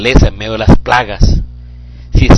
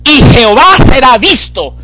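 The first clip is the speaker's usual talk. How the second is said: much higher and louder, crying out; preaching at full force; 8 dB louder than usual. Acted. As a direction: shout the verse over the congregation. (predicación a todo pulmón, mucho más aguda)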